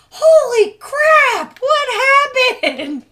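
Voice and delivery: loud, slow, high shocked yell; shocked shout. Retold or imitated. Friend yells in shock at the broken window, slow and high. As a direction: shriek it out in shock.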